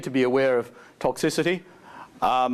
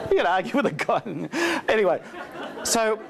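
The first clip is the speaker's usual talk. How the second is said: laughing; 8 semitones higher than usual. Real, not acted. Still laughing after punchline.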